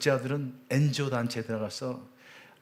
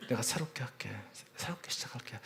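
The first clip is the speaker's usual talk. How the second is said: whisper; 9 dB quieter than usual. Retold or imitated. Father kneeling, begging his sons.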